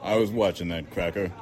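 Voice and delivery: plain reading voice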